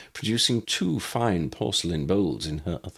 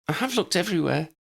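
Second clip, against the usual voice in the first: high, fast fretful voice; anxious neighbour; 11 semitones higher, faster than usual. A narrator's reading. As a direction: rush it out, high and worried. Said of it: "Betty faltered".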